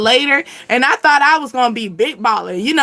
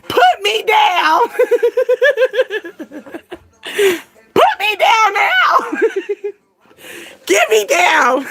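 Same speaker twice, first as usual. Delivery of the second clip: higher, laughing mock shout, slow; mock pleading yell; 8 semitones higher than usual. Retelling the edible, laughing pleas to come down. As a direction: yell it like you're stuck on a ride.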